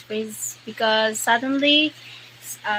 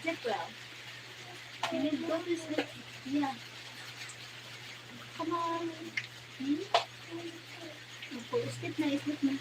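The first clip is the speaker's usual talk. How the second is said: soothing to sleep; 5 semitones higher, 13 dB quieter, faster than usual. Real, not acted. Mother hushing her child to sleep, soft and coaxing. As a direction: gently lull the little one to sleep.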